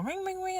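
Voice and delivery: high sung ring ring; phone ring imitation. Vlogger mimics her phone ringing, high and light.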